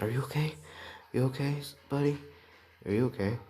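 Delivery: lightly voiced girl checking someone is unhurt; concerned check-in.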